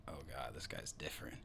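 hushed, low mock-amazed aside; whispered awe. Host recalling receiving a friend's mix, whispered awe. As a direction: whisper it as if privately impressed.

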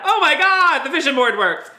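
high, loud, gushing excitement; hammed-up, giddy delight; mock amazement. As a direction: squeal as if a wish came true. Mock shock at the reveal.

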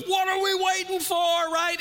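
very high, very loud; rallying shout. Retold or imitated. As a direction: yell it like a fired-up trainer. Trainer's rallying shout retold.

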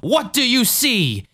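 slow, high-swooping preacher voice; Jim Jones. Acted. Preacher heals a lady wearing glasses, slow theatrical voice. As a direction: draw out each phrase like a faith healer. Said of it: "Jim Jones".

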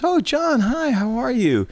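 high, gushing retold hello; drawn-out high greeting; receptionist greeting. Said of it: "one of the women that worked there".

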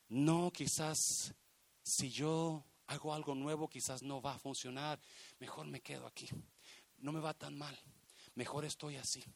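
soft, low, hesitant reasoning; subdued, halting murmur of resignation; timid self-talk. Acted. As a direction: voice someone talking themselves into staying. Voices fearful people staying put.

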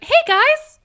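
put-on high bright young Brenda; young bubbly voice. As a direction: squeal a cheery greeting. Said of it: "Brenda at twenty was like".

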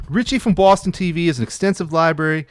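lightly put-on Boston accent, ad pitch. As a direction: sell the library like a proud Boston fan. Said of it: "read it in a Boston accent".

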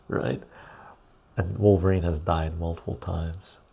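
usual speaking voice

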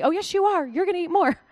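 high, loud insistence, won't take no; a pushy friend overruling a refusal at the table; pushy friend insisting. Retold or imitated.

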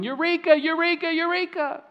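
high, loud, triumphant eureka cries, fading in volume; excited mock shout. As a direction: shout it running down the street.

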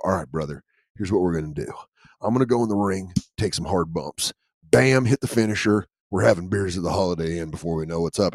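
low, soft gruff wrestler pep talk; old wrestler voice. Retold or imitated. Old wrestler coaching his dog tag partner in a low voice. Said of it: "an old wrestler".

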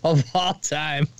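high-pitched, through laughter; laughing delight. Real, not acted. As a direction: say it while cracking up. Bursts out laughing at premise.

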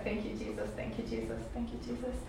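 very soft, murmured repeated thanks; barely voiced, re-enacted gratitude; hushed thanks. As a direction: murmur it like a sleepy prayer. Re-enacts her hushed response in dream.

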